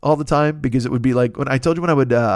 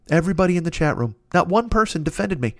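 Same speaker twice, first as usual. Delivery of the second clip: ordinary talk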